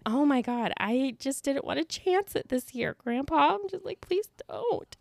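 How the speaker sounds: high, exasperated retold plea; mock pleading. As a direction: beg Grandpa in a small, high voice. Acted plea to grandpa to drop it.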